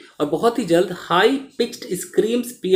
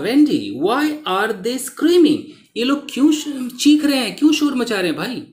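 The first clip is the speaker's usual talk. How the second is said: higher-pitched, mildly acted bewildered question; puzzled; 6 semitones higher than usual. A narrator's reading. Creo asks Wendy why people are screaming, mildly puzzled. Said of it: "Puzzled, Creo asked".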